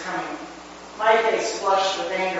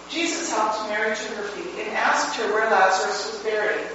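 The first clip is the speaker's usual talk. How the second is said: ordinary delivery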